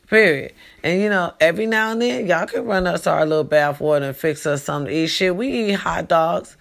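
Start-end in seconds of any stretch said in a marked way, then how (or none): none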